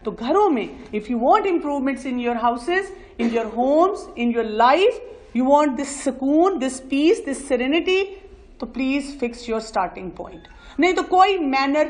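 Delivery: normal talking voice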